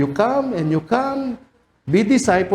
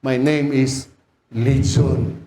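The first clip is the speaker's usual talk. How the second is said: demon answering; 6 semitones lower, much slower than usual. Acted. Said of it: "Sabi nung na, nagpo-possess".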